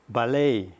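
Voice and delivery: ballet stressed on the last syllable, "balLET"; American ballet. Shown as a demonstration. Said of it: "acento da palavra".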